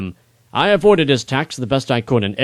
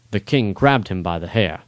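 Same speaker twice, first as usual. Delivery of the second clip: ordinary delivery, nothing marked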